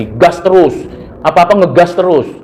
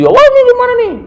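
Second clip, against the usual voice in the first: sudden high-pitched squawk of irritation; mock angry outburst; faster than usual. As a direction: play the grump who snaps over a chair. Mimicking hot-tempered person over chair. (tiruan orang pemarah yang tiba-tiba melengking kesal)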